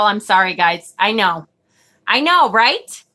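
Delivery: usual speaking voice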